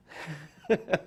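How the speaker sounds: high burst of laughter; real laugh. Real, not acted. Storyteller amused by the agent's remark, high laugh. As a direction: crack up at your own story.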